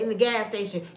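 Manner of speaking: normal talking voice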